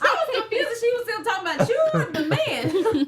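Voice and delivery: high, loud laughing words. Real, not acted. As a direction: crack up while saying it. Laughing about the voice mix-up.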